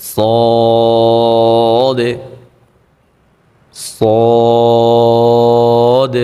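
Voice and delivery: সদ chanted long and thick; heavy full-mouth Sad. Shown as a demonstration. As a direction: fill the mouth and hold সদ. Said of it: "মোটা করে মুখ ভরে". (মুখ ভরে মোটা সদ)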